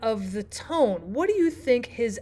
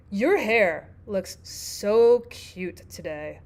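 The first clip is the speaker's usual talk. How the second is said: slow, drawn-out sarcastic compliment; 3 semitones lower, much slower than usual. Shown as a demonstration. Teacher shows how tone spoils a compliment, sarcastic. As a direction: stretch the words so nobody believes the praise. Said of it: "maybe even a sarcastic remark".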